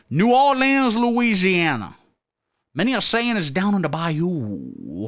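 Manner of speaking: put-on high, drawn-out Cajun accent, Louisiana style. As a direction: say it like a swamp-country Cajun from the bayou. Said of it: "the New Orleans accent is more fun to do".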